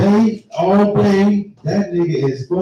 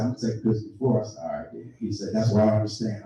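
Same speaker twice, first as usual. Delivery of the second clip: quiet; 7 semitones lower, 9 dB quieter than usual. Retold or imitated.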